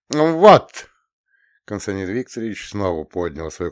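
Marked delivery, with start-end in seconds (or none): 0.1-0.9 triumphant loud exclamation; loud counselor. Acted. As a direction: shout it out proudly. Counselor raises flag.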